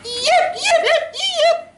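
very high-pitched yipping yells; shrill yips like a charging Confederate; rebel yell. Shown as a demonstration. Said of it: "let out a high-pitched yip".

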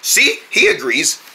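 normal talking voice

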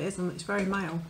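talking between bites; chewing chocolate; mouth partly full. Real, not acted. Seen 2: Tasting face throughout; raises chocolate to mouth and bites from 4.7.

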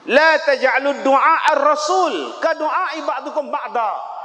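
slow, loud chanted Arabic verse; Quranic recitation. Acted. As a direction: recite with solemn force. (bacaan ayat yang lantang dan perlahan)

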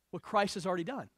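ordinary delivery

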